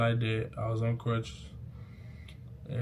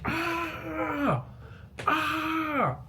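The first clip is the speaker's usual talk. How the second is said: pained cry; 3 dB quieter than usual. Retold or imitated. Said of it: "I said".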